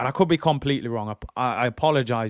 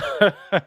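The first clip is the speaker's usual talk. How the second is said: laugh; 6 semitones higher, 4 dB louder than usual. Real, not acted. Laughs after Wenger impression.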